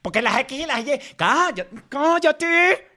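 high, insistent "shut up"; heated shutdown. Real, not acted. Arguing over the other to silence them, high and exasperated. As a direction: cut them off, voice pitched right up.

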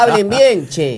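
high, loud mock scold through laughter; laughing. Real, not acted. Teasing the others about their pronunciation, loud laughing mock scold.

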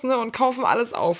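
usual speaking voice

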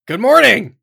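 loud, high hailing shout; calling out. Acted. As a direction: holler a big greeting across to strangers. Calling out to strangers digging.

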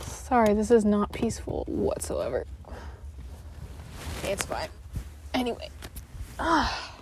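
ordinary talk, nothing marked

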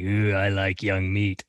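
a hint of a leering old governor, drawn out; mild creepy leer at a young woman; creepy old man. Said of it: "he's all creepy into her".

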